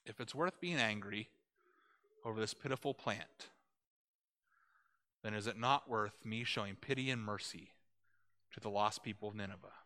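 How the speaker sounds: soft, gentle, measured question; God gently questioning Jonah, soft and patient; calm divine reply. Retold or imitated. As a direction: answer patiently like a wise father. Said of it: "And God says".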